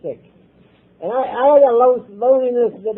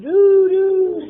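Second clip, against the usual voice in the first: high hummed doo doo like a passing train; imitated train hum; 11 semitones higher, 4 dB louder than usual. Telling the room about the night train, a hummed train sound. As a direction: mimic the train's call.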